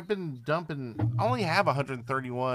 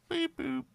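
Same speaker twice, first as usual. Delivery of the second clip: robot beep boop. Pretend. Robot sound effect spoken.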